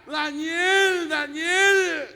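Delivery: high, loud call of a name across the den; a king shouting the name, high and loud. Retold or imitated. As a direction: call out to Daniel down in the lions' den. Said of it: "y le grita".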